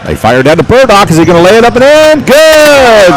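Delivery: live play-by-play peak; excited call. Real, not acted. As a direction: build to a yell on good. Fast break layup call.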